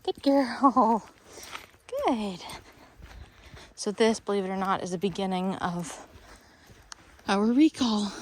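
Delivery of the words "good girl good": breathless praise to the dog; slightly winded dog praise; out of breath. Real, not acted. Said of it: "Excuse me being out of breath".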